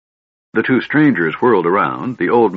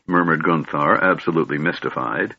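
ordinary delivery, nothing marked

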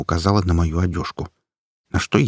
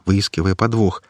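ordinary delivery

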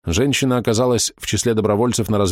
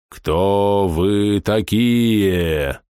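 very slow, menacing creature voice; terrifying voice; much slower than usual. Acted. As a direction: drag every word out like a monster. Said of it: "устрашающий голос".

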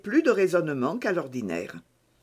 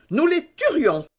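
voix d'enfant aiguë et plus forte, bravache; le petit héros qui veut tuer les loups; enfant héroïque; 11 semitones higher, 6 dB louder than usual. A narrator's reading. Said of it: "en prenant une pose héroïque". (enfant bravache, voix aiguë)

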